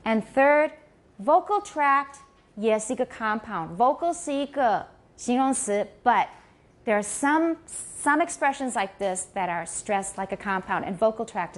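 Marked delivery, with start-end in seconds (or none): none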